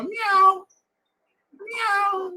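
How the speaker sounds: high mewing meow, like a stray cat at the door. Retold or imitated. Preacher imitating a stray cat meowing at the door. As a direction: mew it high and thin like an alley cat.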